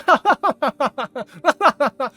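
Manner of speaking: loud, high put-on laugh; mock clueless laugh. Vlogger acts out someone laughing at their own mockery, loud and high. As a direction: laugh along without getting the joke.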